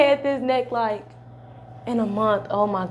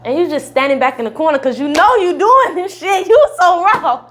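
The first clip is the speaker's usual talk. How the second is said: higher and much louder, hyping him up; excited hype; 6 semitones higher, 8 dB louder than usual. Real, not acted. Patient praising the doctor in the corner, excited hype. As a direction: gas him up loudly.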